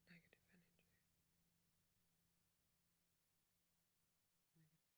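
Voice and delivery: fading, hushed whisper that guides him inward; guided meditation whisper. Acted.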